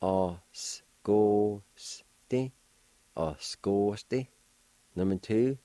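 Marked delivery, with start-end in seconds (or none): 0.0-1.6 Osgoste said slowly syllable by syllable; Cherokee word. Shown as a demonstration. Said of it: "It's pronounced like this". 3.2-4.3 Osgoste said slowly syllable by syllable; Cherokee word. Shown as a demonstration. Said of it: "It's pronounced like this".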